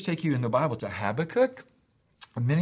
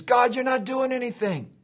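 higher, pleading cry to God; a mild acted plea, raised in pitch; Habakkuk's complaint; 7 semitones higher than usual. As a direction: cry out to God like the frustrated prophet. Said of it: "He says".

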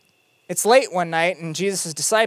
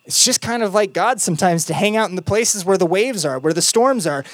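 ordinary talk

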